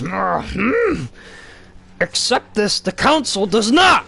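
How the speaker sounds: high, slow, strained little Yoda croak. Retold or imitated. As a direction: squeeze the voice up like the old Jedi master. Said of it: "and Yoda says".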